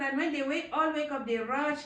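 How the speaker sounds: ordinary talk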